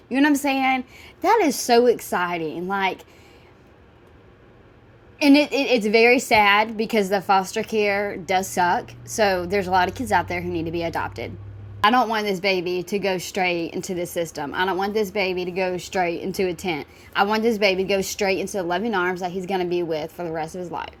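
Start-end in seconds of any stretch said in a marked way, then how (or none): none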